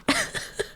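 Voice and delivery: high breathy unvoiced giggle; a quick airy laugh. Real, not acted. Streamer giggles before the McDonald's story.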